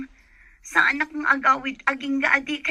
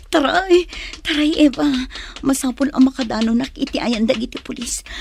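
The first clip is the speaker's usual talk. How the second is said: urgent shout; 5 dB louder than usual. Acted. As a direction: push yourself to flee. Girl urging herself to run. (napigsa nga ikkis)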